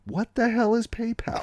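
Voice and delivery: very high put-on voice, bewildered; baffled informant. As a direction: squeak it out like a confused old-timer.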